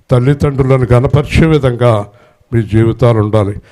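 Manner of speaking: ordinary talk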